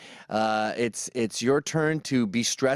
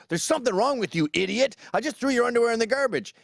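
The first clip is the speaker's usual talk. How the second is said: higher, fast, scolding rant; hammed-up angry dad yelling; mock angry dad; 8 semitones higher, faster than usual. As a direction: snap at the kid like the bad cop. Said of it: "And you can't have all the".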